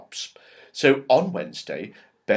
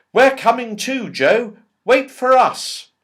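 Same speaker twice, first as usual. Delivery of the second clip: put-on excited children calling; 12 semitones higher, 8 dB louder than usual. Children calling after Joe at night.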